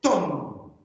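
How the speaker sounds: sharp, high stressed "Ton"; stressed final syllable. Shown as a demonstration. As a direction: punch "Ton" as the accented syllable. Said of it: "we have to go a bit higher and sharper".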